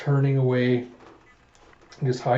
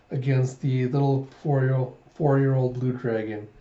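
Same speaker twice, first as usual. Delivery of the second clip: ordinary delivery, nothing marked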